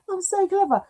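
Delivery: laughing at herself; sarcastic laugh at own blunder. Real, not acted. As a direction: giggle through the self-mockery. Laughs at her own mistake.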